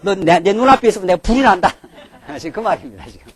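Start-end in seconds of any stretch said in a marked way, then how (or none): 0.0-1.7 loud, heated fatherly outburst; a father's loud mock rage; angry father. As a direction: blow up like a furious parent. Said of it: "집에서 화가 한번 나면은".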